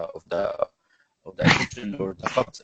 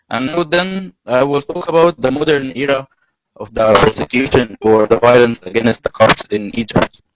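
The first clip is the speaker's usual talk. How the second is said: louder, projected talk; speaking louder; 7 dB louder than usual. Real, not acted. Presenting the modern era to listeners, louder. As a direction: keep the voice up for the audience. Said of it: "I'll try to be louder".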